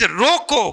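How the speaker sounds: रोको drawn out, then a break; comma after रोको. Shown as a demonstration. Speaker showing the other meaning, रोको set apart. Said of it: "comma".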